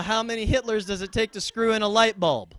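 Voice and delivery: ordinary delivery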